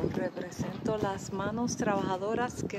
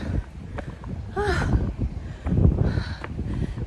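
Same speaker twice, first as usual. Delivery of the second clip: long, high breathy exhale; exhausted high sigh; 11 semitones higher than usual. Real, not acted. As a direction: blow out the effort. After climbing stairs.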